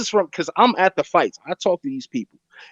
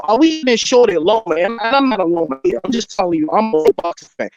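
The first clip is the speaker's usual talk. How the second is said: ordinary talk, nothing marked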